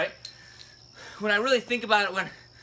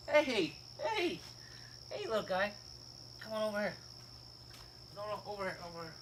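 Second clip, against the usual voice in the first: hushed, lilting "come here" voice; coaxing call; 2 semitones higher, 9 dB quieter, faster than usual. Real, not acted. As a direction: gently call a little guy over, keeping it quiet. Breaks off to call a little one over.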